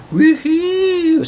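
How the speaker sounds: a long high-pitched woohoo; high excited whoop. Real, not acted. Vlogger whooping as his portfolio goes green. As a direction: cheer like you hit the jackpot.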